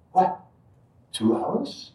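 mock surprise, pitch jumping up; retold disbelief. Teller recalls learning they waited to start the puja, incredulous.

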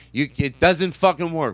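usual speaking voice

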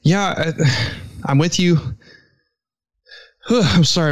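out of breath from running; winded, catching breath. Real, not acted. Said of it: "I'm out of breath".

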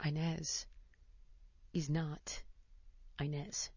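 quiet and slow, with pauses between words; hushed reveal. Retold or imitated. Mom revealing the housekeeper's real name, hushed and slow. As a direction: drop the voice and let each word land.

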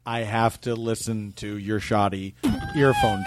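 slow, low mock contrition; sarcastic apology. Guest insults the engineer's work while apologizing, mock-solemn. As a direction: drag it out with false solemnity.

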